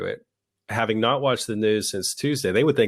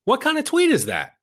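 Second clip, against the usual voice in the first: much higher, exasperated disbelief; incredulous high squeal. Real, not acted. Host mocking a politician's tweet, in a high incredulous squeal. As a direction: shriek the question in disbelief.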